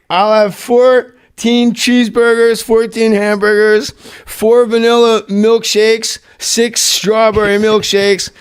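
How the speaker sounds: Farley piling up a huge drive-thru order, slow and high; slow drawn-out order. Retold or imitated. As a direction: read off a huge order slowly, savoring each item. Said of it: "He goes".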